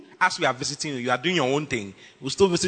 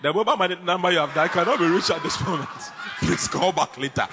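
fast, mechanical automated-operator voice; mock recorded operator, fast and stiff; network recording impression. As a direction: fake the phone-company message. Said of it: "It's a lady who normally, normally says that, but you with your male voice".

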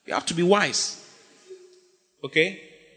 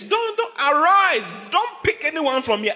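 much higher, urgent father's command; a hint of an insistent father; 9 semitones higher than usual. Isaac urging Jacob to leave, high urgent command.

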